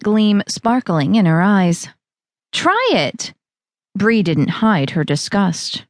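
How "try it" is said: Val's voice. Acted. Val offers her drink.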